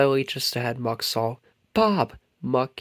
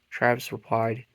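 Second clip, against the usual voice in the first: plain reading voice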